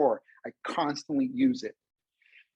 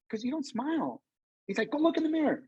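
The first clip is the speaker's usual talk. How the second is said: imitating friend; 10 semitones higher than usual.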